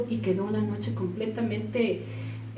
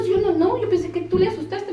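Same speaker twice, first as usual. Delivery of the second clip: high, loud rushed denial; imitating her own frightened denial; flustered denial; 11 semitones higher, 6 dB louder than usual. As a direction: protest your innocence in alarm. Friends accusing each other in fright.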